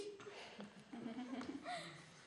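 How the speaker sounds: unvoiced-sounding chuckle tailing off; soft laugh, dying away. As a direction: chuckle at your own easy answer. Laughs after own wrong sum.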